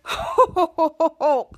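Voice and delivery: lightly voiced dazed Santa ho ho; a wobbly, stunned Santa chuckle; hint of a jolly Santa laughing.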